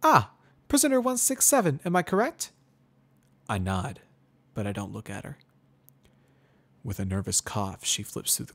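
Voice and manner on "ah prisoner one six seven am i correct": put-on much higher, louder young mare, forced friendly; Twilight Sparkle. A narrator's reading. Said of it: "Her expression turns to one of concentration".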